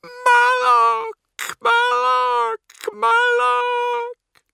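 very high, loud, wailing scream. A narrator's reading. Possessed Cat cries out a meaningless word, screaming. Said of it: "she screams".

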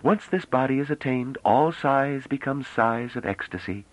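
mock solemn and slow. Bliss gravely answers Pellerat's gallantry, slow and mock solemn. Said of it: "said the woman solemnly".

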